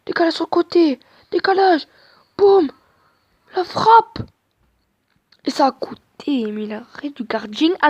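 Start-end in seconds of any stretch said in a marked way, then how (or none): 0.1-4.2 de plus en plus haut et fort jusqu'au tir; commentaire qui monte. Real, not acted. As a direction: fait monter la tension jusqu'à la frappe. Commente l'action en direct, crescendo. (crescendo de commentateur sportif)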